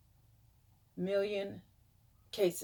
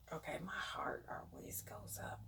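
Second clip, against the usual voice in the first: sighing whispered nerves; anxious hushed whisper; nervous whisper; 4 semitones lower, 16 dB quieter than usual. Real, not acted. Nervous before reading numbers.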